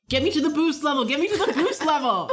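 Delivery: put-on high, laughing kid begging; eager kid voice. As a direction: beg like a thrilled child. Voicing eager kids.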